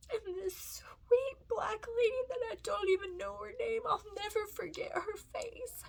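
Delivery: high, tearful, voice breaking; overcome, pushing words through tears; crying. Real, not acted. Mom remembering the kind stranger's face, crying. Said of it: "that's not why I'm crying".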